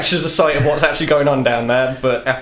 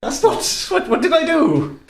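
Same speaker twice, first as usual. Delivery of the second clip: much higher, wide-eyed innocence; high innocent protest; 14 semitones higher than usual. Acted. Prance protesting his innocence to the painter, high. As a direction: squeak out the denial.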